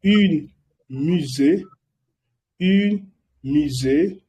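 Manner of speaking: Un musée very slow and careful; slow listen-and-repeat model; slow repetition. Shown as a demonstration. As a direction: draw out un musée so they can copy. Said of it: "Estoy repitiendo muchas veces".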